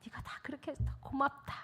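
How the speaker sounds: hushed, breath-soft gratitude; God's gentle thanks. Retold or imitated. God thanking the helper for rides, soft hushed voice. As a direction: softly thank someone as God would. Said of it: "그러시는 거예요".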